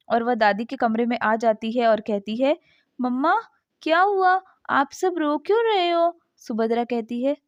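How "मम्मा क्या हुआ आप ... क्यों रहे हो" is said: lightly voiced small girl, higher pitch; a child asking, worried and curious; little girl. Little girl wakes, asks. (हल्की-सी छोटी बच्ची की आवाज़)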